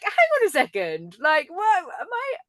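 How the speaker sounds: high, loud, fast mock disbelief; mock shock. Remembering callers mistook her for her brother, she squeaks in mock shock. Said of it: "And I was like".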